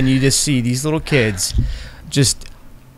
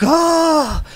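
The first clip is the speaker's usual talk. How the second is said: put-on kids scream. Kids scream at the gods dying.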